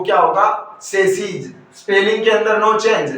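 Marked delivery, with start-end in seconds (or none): 0.8-1.5 सेसीज said "SAS-eez"; plural pronunciation. Shown as a demonstration. Teacher showing the plural to students, pronounced "sas-eez". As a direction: say सेसीज with a final z or j sound. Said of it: "plural के अंदर इसका जो pronunciation".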